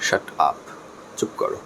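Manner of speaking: soft, low, polite hushing; gentle shut up. Shown as a demonstration. Teacher asking a talking student to stop, gently. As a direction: ask for silence nicely, quietly and low. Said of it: "খুব সুন্দর করে বললাম".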